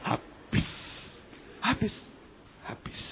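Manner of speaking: soft, voiceless repetitions trailing away; hushed, spaced-out echo; fading echo. Retold or imitated. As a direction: let the word die out with long pauses. Echoing the news, fading into silence.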